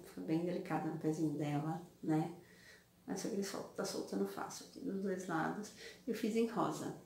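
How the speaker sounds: ordinary talk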